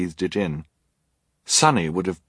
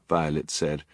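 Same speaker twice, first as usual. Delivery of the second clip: usual speaking voice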